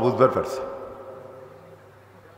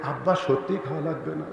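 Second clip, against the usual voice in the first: meek, much softer murmur; mumbling son, scared to be overheard; timid mumble; 2 semitones lower, 12 dB quieter than usual. Retold or imitated. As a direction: ask it sheepishly, afraid of the wife. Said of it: "মিন মিন করে কয়".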